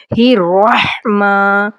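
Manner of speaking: ordinary talk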